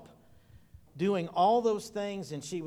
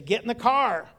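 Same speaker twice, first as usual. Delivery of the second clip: firmer call; 6 semitones higher, 7 dB louder than usual. Retold or imitated. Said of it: "And she'd say".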